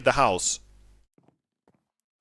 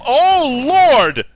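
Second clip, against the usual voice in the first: much louder, higher panicked outcry; panicked shout; 9 semitones higher, 9 dB louder, slower than usual. Real, not acted. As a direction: yell in real alarm. Zombie attack overwhelms.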